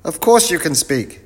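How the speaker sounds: loud, very high professor voice; muddled professor. Acted. Professor mishears the greeting, answers loud and high. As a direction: boom it out high, quite sure of yourself.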